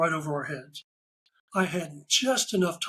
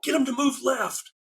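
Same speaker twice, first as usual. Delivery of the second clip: higher-pitched barking of orders at a group photo; retold yelling; 7 semitones higher than usual. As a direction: call out directions across the tarmac, a bit higher. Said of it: "and I'm yelling".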